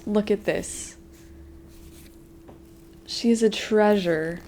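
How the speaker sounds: usual speaking voice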